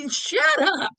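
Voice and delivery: high, scoffing shut up; scornful dismissal. Real, not acted. Reviewer scoffing at the self-hating claim, high scornful shut up. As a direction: brush it off with scorn.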